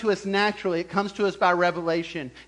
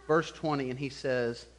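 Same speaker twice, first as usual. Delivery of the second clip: ordinary talk, nothing marked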